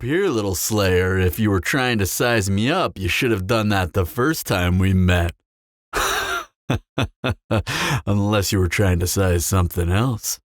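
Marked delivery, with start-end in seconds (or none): 5.9-6.4 short high teasing laugh; amused chuckle. Acted. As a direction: laugh at her lightly, pleased with yourself. Teasing vampire chuckles at slayer.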